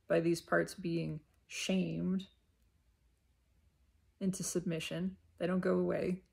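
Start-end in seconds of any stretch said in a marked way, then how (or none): none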